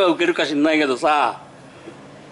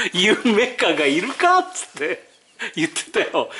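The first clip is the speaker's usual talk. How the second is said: ordinary delivery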